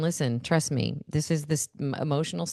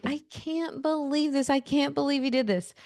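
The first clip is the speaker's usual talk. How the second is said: high-pitched, softer, imitating exasperated disbelief; 7 semitones higher, 4 dB quieter than usual. Mother seeing the stuck four-wheeler, high and exasperated. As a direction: let the words rise in stunned dismay, then sink. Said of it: "when you're like".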